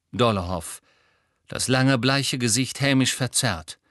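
usual speaking voice

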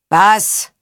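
curt bark from above; gebellt. A narrator's reading. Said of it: "bellte er herab". (herabgebellt, laut und knapp)

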